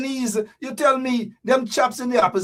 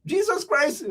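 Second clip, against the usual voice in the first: ordinary talk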